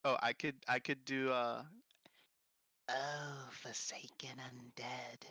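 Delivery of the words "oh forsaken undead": put-on soft, slow old crone whisper; Dark Souls old lady. To his friend, doing the Dark Souls old lady, slow soft crone. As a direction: breathe it like an ancient hag. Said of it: "that old lady? in Dark Souls".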